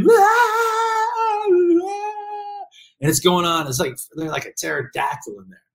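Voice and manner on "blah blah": mock tantrum wail. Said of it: "They're screaming".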